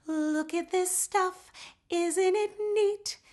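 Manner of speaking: high, slow line held back in the head; head voice placement. Shown as a demonstration. Teacher contrasts head placement with forward placement. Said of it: "Instead of it being back in the head".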